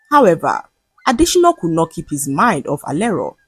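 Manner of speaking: plain reading voice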